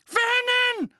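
high, loud scream for her husband; very high-pitched panicked call; alarmed scream. Acted. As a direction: shriek his name in alarm.

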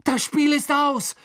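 high, loud cry building in force; excited shout. A narrator's reading. Harry sees Krumm catch the Snitch, excited shout. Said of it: "rief Harry".

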